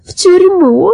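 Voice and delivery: high, loud cry of dismay; tearful wail. Acted. Old women dreading prison for gathering brushwood, tearful wail. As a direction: wail it high, without real sobs. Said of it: "заплакали старушки".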